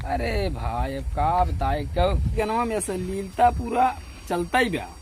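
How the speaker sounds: ordinary talk, nothing marked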